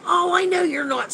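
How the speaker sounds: usual speaking voice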